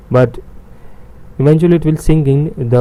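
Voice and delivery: ordinary talk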